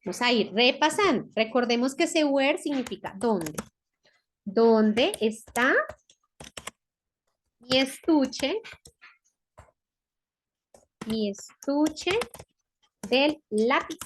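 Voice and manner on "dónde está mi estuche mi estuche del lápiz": slow, word groups parted by long pauses; slow dictation. Shown as a demonstration. Teacher writing out the translation for pupils, slow dictation. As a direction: dictate it slowly, phrase by phrase.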